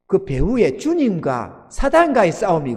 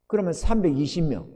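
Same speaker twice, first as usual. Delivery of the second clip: normal talking voice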